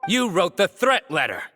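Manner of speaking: nail him with the charge; sharp accusing call. Acted. Detective confronts the culprit.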